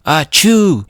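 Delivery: big, high, loud acted sneeze; exaggerated pretend sneeze; mock sneeze. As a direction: burst out a spelt-out achoo. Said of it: "ends up sneezing right in".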